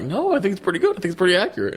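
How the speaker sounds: high, mock-earnest imitation; defensive retort, imitated. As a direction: mimic him protesting in a high voice. Retells James defending his impression.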